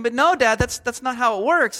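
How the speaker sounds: lightly voiced overwhelmed child; child protesting. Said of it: "they're completely overwhelmed".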